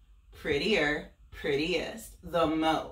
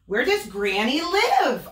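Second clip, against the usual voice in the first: higher, sweetly innocent asking; sly wolf question; 9 semitones higher, 6 dB louder than usual. Acted. Wolf sweetly asking Little Red the way. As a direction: fake friendly tone.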